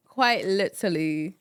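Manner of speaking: posh London lilt; clipped London vowels; London accent. Pretend. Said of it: "we gotta work on your London accent".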